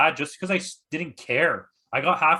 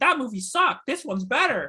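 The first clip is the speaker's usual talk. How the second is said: mock fickle fan; 9 semitones higher than usual. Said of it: "they're like".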